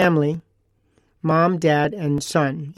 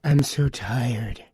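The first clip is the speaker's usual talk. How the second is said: slow, lower, softer proud weariness; tired badge of honor; 5 semitones lower, 4 dB quieter, much slower than usual. Imitating a shopper who answers he's worn out, slow and low. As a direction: wear the tiredness like a medal. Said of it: "almost like a badge of honor".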